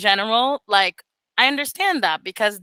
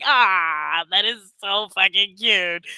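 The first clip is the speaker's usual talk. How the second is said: melting at cuteness; 4 semitones lower, slower than usual. Retold or imitated. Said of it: "And I was like".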